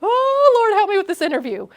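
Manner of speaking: bright, rising confident pep; upbeat faith. Pretend. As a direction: talk yourself into hope. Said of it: "I'm trying to be like".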